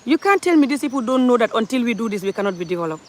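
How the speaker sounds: normal talking voice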